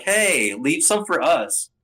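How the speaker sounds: a hammed-up protest, slowed on the last words; mock complaint. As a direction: play the crewmate who wants a share of the fight. Crewmates complaining in retold scene.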